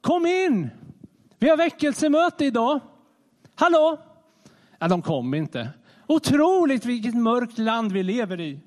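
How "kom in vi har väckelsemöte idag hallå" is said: high, louder mock call from a distance; hammed-up call to outsiders standing far off. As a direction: shout an invite from far away. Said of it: "Står på avstånd och ropar bara".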